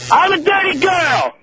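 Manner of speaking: slow high shout; yelling. Pretend. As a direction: yell it out across the room. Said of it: "really yell very loud for me".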